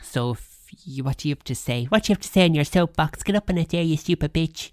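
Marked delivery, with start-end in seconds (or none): 1.8-4.7 mock bossy. Mock order to get on soapbox.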